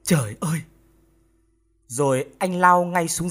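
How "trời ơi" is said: mild, understated wail; a touch of anguished exclamation; narrated anguished cry. A narrator's reading. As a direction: cry out in shock, held back. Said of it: "Phong chỉ kịp gào lên".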